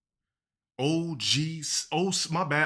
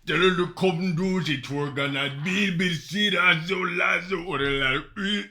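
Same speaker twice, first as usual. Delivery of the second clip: fake Jamaican accent; 3 semitones higher, 3 dB louder than usual. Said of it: "I can fake a Jamaican accent".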